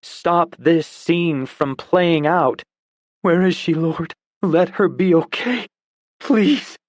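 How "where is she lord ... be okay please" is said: higher, pleading prayer; desperate prayer. Acted. Dawson prays running toward her, pleading higher. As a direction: beg God, panicked.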